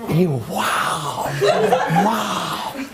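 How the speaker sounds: mock over-the-top wows, long and high; wow exagéré. Shown as a demonstration. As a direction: overplay the amazement, drawing each wow out. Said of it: "ça n'a pas besoin d'être". (émerveillement surjoué)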